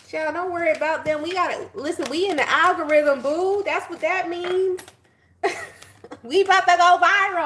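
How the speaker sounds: high and loud, delighted; gleeful hype. Real, not acted. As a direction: crow about going viral. Gleeful about algorithm.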